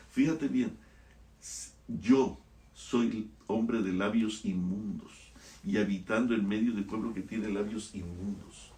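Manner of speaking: normal talking voice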